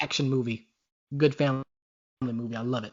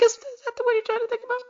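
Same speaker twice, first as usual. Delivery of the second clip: teasing; 4 dB quieter, much faster than usual. Acted. Teasing fast high question.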